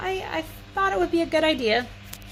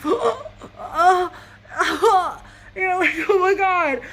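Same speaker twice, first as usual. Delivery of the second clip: higher, louder pained laugh-cry; half laughing, half crying through the swab; pained gasping oh; 4 semitones higher, 4 dB louder, slower than usual. Retold or imitated. Patient getting a nose swab, laugh-crying in pain.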